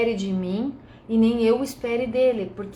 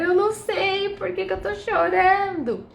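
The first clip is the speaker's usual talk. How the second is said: high, wailing mock despair; desperate outburst; 8 semitones higher than usual. Acting out a crying woman who can't explain why, desperate. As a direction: act out despairing confusion, pitched way up. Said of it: "ela se desespera".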